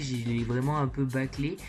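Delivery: ordinary delivery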